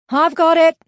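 loud, high shouted reply; calling back. A narrator's reading. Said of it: "he called back".

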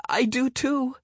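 put-on high stubborn kid voice; insistent little boy. A narrator's reading. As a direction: insist like a small child.

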